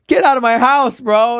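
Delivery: high, loud acted dismissal; retold exasperated outburst. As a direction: act out throwing someone out.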